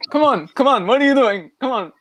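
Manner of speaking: loud, high imitation of goading; [name] doing Nick Diaz. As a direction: mimic someone badly imitating a fighter. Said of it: "when he did a Nick Diaz impression".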